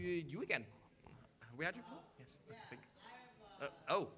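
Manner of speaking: ordinary talk, nothing marked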